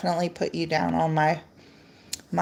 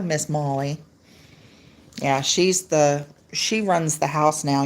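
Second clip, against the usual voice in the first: ordinary talk, nothing marked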